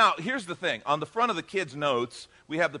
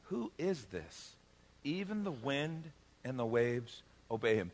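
slow, hushed wonder; quiet, drawn-out astonishment; awed fear; 4 semitones lower, 6 dB quieter, slower than usual. Acted. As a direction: ask softly, stunned and a little afraid. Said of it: "They were terrified".

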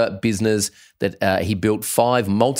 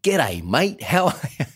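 higher, lightly hammed-up g'day greeting; imitated Aussie greeting; 7 semitones higher than usual. Podcast guest greeting the host back, imitated hammed-up Aussie greeting. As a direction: mimic a guest's g'day, pitch climbing at the end. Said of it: "And he said".

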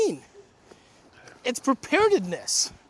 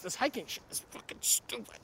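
muttering; 5 semitones lower, 8 dB quieter than usual. Real, not acted. Grumbles after effort.

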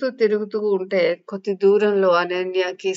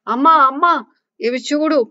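excited call; 8 semitones higher, 6 dB louder than usual. Acted. Excited child calls mother.

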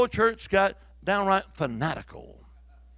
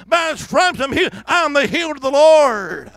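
congregation shouting aloud; 7 semitones higher, 11 dB louder than usual. Retold or imitated. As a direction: shout it as a whole church would. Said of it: "whole church is shouting aloud".

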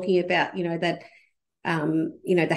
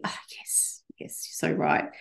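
breathy whisper of agreement; 2 semitones lower, 7 dB quieter, slower than usual. Pretend. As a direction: whisper it like a secret nod. Imagined listeners agreeing.

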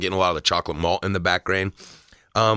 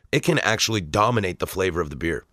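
ordinary talk, nothing marked

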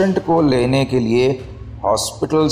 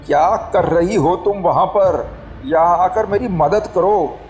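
high-pitched, agitated demand; almost screaming; 8 semitones higher than usual. A narrator's reading. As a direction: scold urgently, pitched well up, short of a scream. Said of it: "लगभग चीखते हुए कहा". (लगभग चीखते हुए, ऊँचे सुर में)

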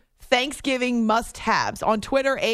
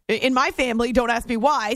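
normal talking voice

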